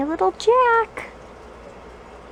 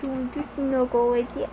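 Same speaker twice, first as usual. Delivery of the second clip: normal talking voice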